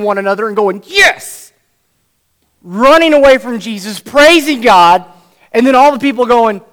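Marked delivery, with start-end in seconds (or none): 0.9-1.5 loud, high triumphant yes; jubilant cheer. Retold or imitated. Friends high-fiving off the roof. 2.6-6.6 rising excitement. Real, not acted. Crowd rejoicing after healing.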